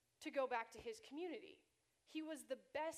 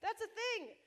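defensive; 8 semitones higher, 10 dB louder than usual. Retold or imitated. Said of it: "And I was like".

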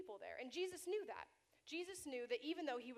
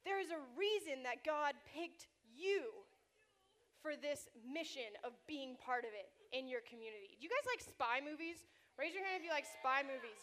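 normal talking voice

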